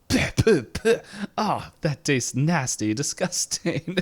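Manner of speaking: high, spitting and gagging; disgusted computer voice. Retold or imitated. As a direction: spit it out in disgust. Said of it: "the computer's just like".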